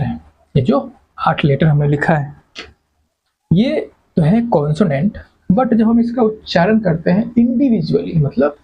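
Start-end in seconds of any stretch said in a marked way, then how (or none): none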